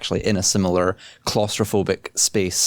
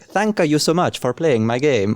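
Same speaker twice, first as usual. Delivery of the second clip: put-on silly Italian accent; 6 semitones higher, slower than usual. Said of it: "doing a silly Italian accent".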